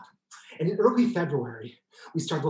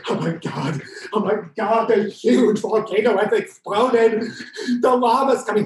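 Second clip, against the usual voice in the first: higher, louder, hammed-up terror; mock panic; 5 semitones higher, 6 dB louder than usual. Pretending to flee lava with audience, hammed-up panic. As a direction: play the doomed disaster-movie hero. Acting out volcano escape game.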